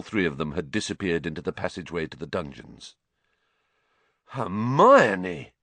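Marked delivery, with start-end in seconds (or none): 4.3-5.5 louder astonished call; amazed, half admiring; stunned and impressed. Acted. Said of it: "sounding both stunned and impressed".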